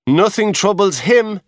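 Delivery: resentful remark about the guard; mildly bitter young porter. Acted.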